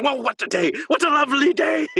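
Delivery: high, hammed-up Mad Max yell; Nicolas Cage quote. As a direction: belt it like a manic Cage. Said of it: "Cage, from Mad Max".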